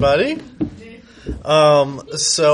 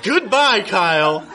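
indignant farewell; 8 semitones higher than usual. Acted. Nate breaks up, storms out.

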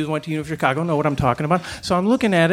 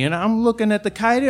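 ordinary talk, nothing marked

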